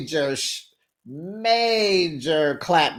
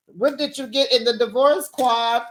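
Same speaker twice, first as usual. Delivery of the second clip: ordinary talk, nothing marked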